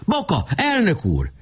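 high call from far away. A narrator's reading. Nemecsek calls to Boka from afar, high call. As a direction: call the name across the lot. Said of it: "Újra kiáltott".

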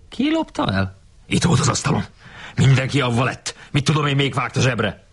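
high-pitched, indignant back-and-forth; mildly hammed-up squabble; acted quarrel. A narrator's reading. Acted quarrel over a stolen knife.

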